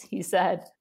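ordinary delivery

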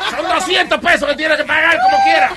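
laughing sermon. Acted. Pastor hands down penance, laughing.

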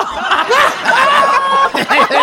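high shrieking laugh; loud laugh. Real, not acted. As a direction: crack up loudly. Classmate cracks up at answer.

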